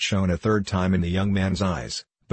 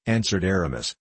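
ordinary delivery

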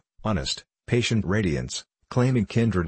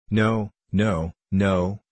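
mildly gleeful refusal; a touch of playful, childish glee; childlike glee; much slower than usual. A narrator's reading. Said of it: "with the glee of a child".

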